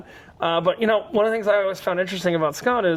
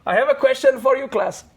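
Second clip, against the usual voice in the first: put-on cooking teacher with Indian accent; 5 semitones higher than usual. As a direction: pose it to the class in an Indian accent. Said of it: "I'm gonna do an Indian accent".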